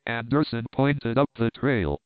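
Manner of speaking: ordinary delivery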